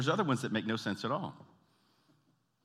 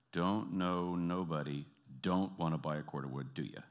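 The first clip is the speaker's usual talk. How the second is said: half-speed, lower and deliberate; slowed-down repeat; 4 semitones lower, 4 dB quieter than usual. Retold or imitated. Slowed repeat after "say that again.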